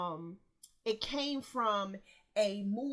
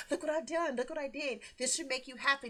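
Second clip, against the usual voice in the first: put-on higher, proud little kid showing off a gift; eager child; 5 semitones higher, faster than usual. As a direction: hand it over beaming like a child. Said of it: "like an eager child".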